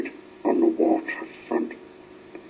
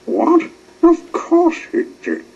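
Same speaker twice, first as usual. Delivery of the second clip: high, loud indignant outburst; shocked and offended, voice jumping up high; indignant squeak, much higher and louder; 9 dB louder than usual. A narrator's reading. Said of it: "said ten cents indignantly".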